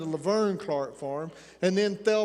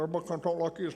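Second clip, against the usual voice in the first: rushed, mumbled Appalachian talk, very fast; mock Appalachian mumble, very fast; mouthful of marbles; much faster than usual. Shown as a demonstration. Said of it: "sounds like a mouthful of marbles".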